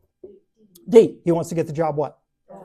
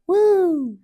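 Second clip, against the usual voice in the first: high excited whoop; teacher's inner voice cheering; mock cheer; 13 semitones higher, 4 dB louder than usual. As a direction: celebrate the free day.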